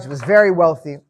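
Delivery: ordinary delivery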